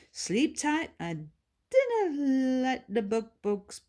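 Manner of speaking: put-on high old Scots lady, drowsy goodnight; tuck-in farewell, slowing at the end; sleepy Bertha.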